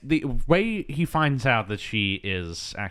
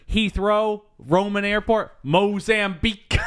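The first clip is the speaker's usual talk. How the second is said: tag; 10 semitones higher, 4 dB louder than usual. Retold or imitated. Henchman listing where others got stranded.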